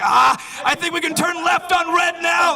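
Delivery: high, frantic yell; mock panic. Drivers in chaos when it rains, high panicked shout. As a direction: imitate a driver losing their mind. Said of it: "it's chaos".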